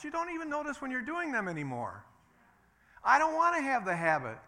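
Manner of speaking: high, loud preaching peak; preaching climax. Real, not acted. Preacher rising at the congregation, loud. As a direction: lift to full force.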